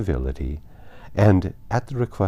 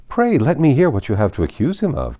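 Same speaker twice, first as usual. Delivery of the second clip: higher and louder, eager to hear; eager cry; 8 semitones higher, 6 dB louder than usual. A narrator's reading. Colonel Fitzwilliam eagerly urging his cousin, higher, louder cry. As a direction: push it out brightly, curious and keen. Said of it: "cried Colonel Fitzwilliam".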